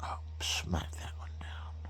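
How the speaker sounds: quick hushed aside; whisper. Real, not acted. Whispering an aside to his co-host. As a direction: whisper it under the breath.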